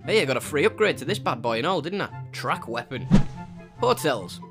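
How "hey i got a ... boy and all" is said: normal talking voice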